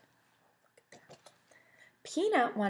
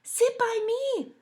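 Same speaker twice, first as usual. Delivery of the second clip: put-on very high, slow girlish plea; sweet, high invitation; Milk asking Cupcake to sit with her; Milk's voice; 6 dB louder, slower than usual. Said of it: "Milk said to Cupcake".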